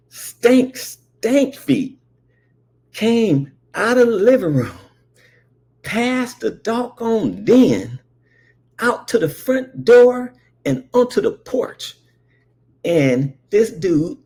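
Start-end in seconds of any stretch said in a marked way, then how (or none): 0.1-12.0 high, loud disgusted drawl on stank; disgusted exaggeration. Comic telling how the stink rolled out, disgusted.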